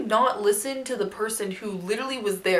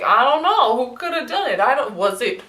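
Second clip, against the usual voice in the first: mock clueless; 3 semitones higher, 6 dB louder than usual. Mocking the council pretending not to know. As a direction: play dumb sarcastically. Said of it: "and the Cliff's like".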